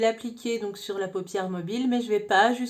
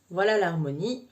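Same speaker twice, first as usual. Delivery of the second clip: usual speaking voice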